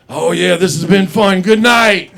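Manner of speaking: put-on loud, high, sloppy drunk send-off; drunk goodbye. Drunk comedian handing back the show, loud high sloppy farewell. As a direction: bellow it like a drunk guest. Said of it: "And Lance is like".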